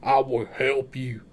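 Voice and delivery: slow, gruff outlaw voice; a light Arthur Morgan growl, drawn out. Retold or imitated. Detective asks the couple for time, as Arthur Morgan. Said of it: "Arthur Morgan".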